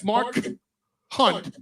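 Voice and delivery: "Mark", a clear pause, then "Hunt"; deliberate gap between "Mark" and "Hunt"; name said separately. Shown as a demonstration. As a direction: keep "Mark" and "Hunt" carefully apart. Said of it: "I have to say that name separately".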